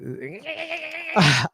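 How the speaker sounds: high-pitched mocking squeal; long, high, goofy noise mimicking a wild guy; imitated Corey noise. Mocking how every Corey behaves, high goofy squeal. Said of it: "They're always like".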